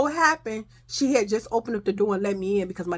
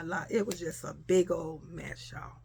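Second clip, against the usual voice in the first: ordinary delivery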